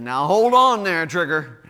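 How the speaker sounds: loud mock crowd pushing back; mock protest. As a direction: shout it like a crowd objecting. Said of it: "that's when they said".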